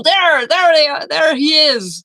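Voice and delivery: a constable below spotting fugitives on the roof, shouting; excited shout. Acted. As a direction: yell to alert the whole street. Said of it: "one of them points at you".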